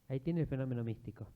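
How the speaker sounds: ordinary talk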